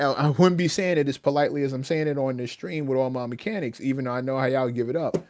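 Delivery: ordinary delivery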